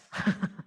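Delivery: short laugh. Real, not acted. Host laughs after joke.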